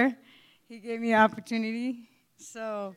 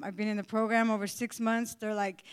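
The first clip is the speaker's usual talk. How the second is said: usual speaking voice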